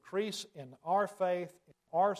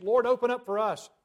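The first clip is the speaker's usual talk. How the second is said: raised, higher cry at a shut door; pleading call; 5 semitones higher, 8 dB louder than usual. Acted. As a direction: beg loudly to be let in. Foolish virgins begging at the door.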